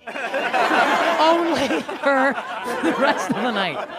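very slow drawn-out repeat; slow emphatic. Real, not acted. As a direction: stretch every word slowly.

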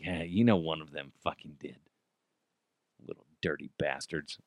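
put-on low, quiet griping about the kids; grumbled resignation. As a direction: grumble it to yourself. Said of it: "and I'm like".

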